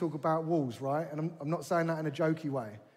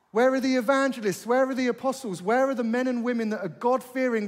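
preaching at full force; 5 semitones higher, 3 dB louder than usual. Acted. Preacher challenging the Mosaic church, loud and soaring. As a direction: hammer each where like a call to arms.

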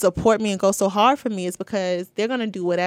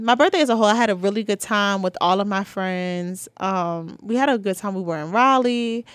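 ordinary talk

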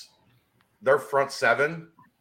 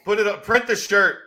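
loud and high, excited; loud sharp voice; 9 semitones higher, 5 dB louder than usual. Real, not acted. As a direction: shout it like a slogan. Excited loud call for shirt.

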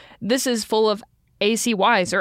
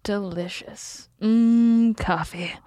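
mock savoring; much slower than usual. Echoing the coffee sip, savoring.

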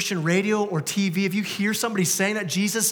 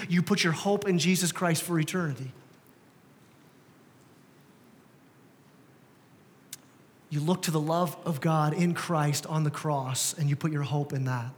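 tender appeal; 5 semitones lower, 5 dB quieter than usual. Real, not acted. Sermon softens to appeal.

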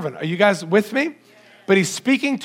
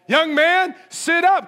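higher, ringing command to the dead; commanding call; 10 semitones higher, slower than usual. Retold or imitated. Preacher acting out Jesus raising the young man, higher commanding call.

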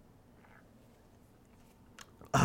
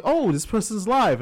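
excited; 9 semitones higher, 5 dB louder than usual. Retold or imitated. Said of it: "and I'm like".

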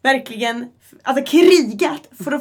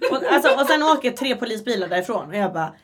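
ordinary talk

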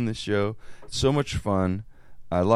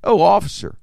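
louder, higher coy plea to the officer; sweet-talking old lady; 5 semitones higher, 7 dB louder than usual. Retold or imitated. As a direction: charm the cop innocently. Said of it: "she goes".

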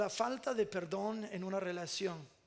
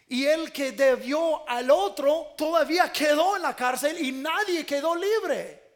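loud, higher shouting preacher; full-force preaching; 7 semitones higher, 10 dB louder than usual. Real, not acted. Pastor proclaiming the parable to his church, shouting. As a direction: thunder the parable's ending at the congregation.

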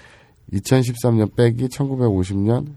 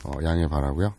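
hoarse from drinking; husky morning-after voice; hungover; 4 semitones lower, 6 dB quieter than usual. Real, not acted. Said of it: "목소리가 많이 잠겼으니까".

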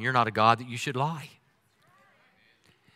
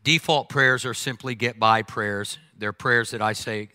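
ordinary talk, nothing marked

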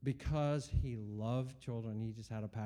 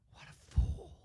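whisper. Retold or imitated. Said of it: "And I'm like".

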